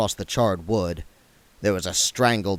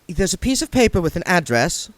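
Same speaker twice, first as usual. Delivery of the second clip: put-on higher girl's voice; young woman voice; 8 semitones higher than usual. A narrator's reading. Saccharissa tells William about the wallet, higher.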